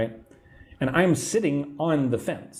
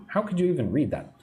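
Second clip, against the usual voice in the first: ordinary talk, nothing marked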